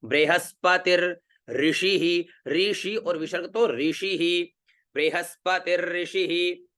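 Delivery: बृहस्पतिर with a held र before ऋषि, said slowly; slow careful pronunciation. Shown as a demonstration. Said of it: "तो क्या हुआ?".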